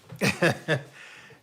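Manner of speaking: short burst of real laughter; laughing. Real, not acted. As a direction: laugh at your own joke. Laughing after telling joke.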